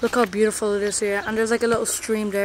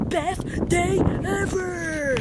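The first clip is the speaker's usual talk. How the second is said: high, slow, stretched-out cheer; best day ever, stretched high and slow; drawn-out happy cheer; 8 semitones higher, much slower than usual. Real, not acted. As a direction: sing it out, delighted. Excited cheer about her day.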